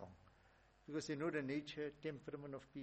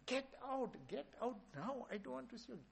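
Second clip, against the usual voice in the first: high yelled orders to leave; pitched-up repeated yelling, get out; screaming get out; 9 semitones higher than usual. Retold or imitated. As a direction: drive him out with shouts. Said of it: "he's screaming and shouting".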